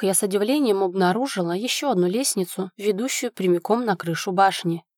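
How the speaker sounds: ordinary delivery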